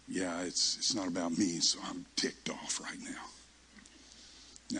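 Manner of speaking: low, soft grudging admission; muted resentment. Retold or imitated. Preacher accepting the rebuke while ticked off, low grudging resentment. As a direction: sink low and trail into near-whisper.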